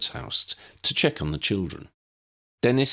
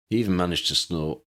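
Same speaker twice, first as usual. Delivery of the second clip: ordinary delivery, nothing marked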